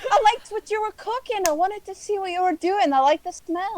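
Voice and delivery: high meek explaining voice; sheepish and small; pleading excuse. Acted. Druid excusing herself to the angry dwarf.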